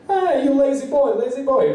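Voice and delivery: imitated jeering yell, pitched high; mock screaming. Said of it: "always screaming at him".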